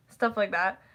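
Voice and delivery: ordinary delivery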